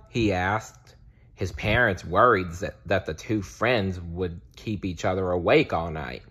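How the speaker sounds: ordinary delivery, nothing marked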